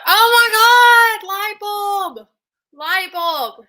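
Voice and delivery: high, loud, slow gasp of discovery; excited realization. Retold or imitated. Retelling a shopper who suddenly knows the word, high excited realization. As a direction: act out a sudden light-bulb moment. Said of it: "And she's like".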